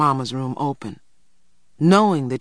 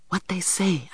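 whisper; 5 semitones higher, 5 dB quieter than usual. A narrator's reading. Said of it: "I whispered".

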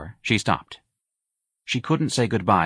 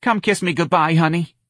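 high, coaxing mother; pleading; 9 semitones higher, 3 dB louder than usual. A narrator's reading. Mother begging her son for a goodbye, pleading. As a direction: coax tenderly in a raised voice. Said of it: "Missus Riley pleaded".

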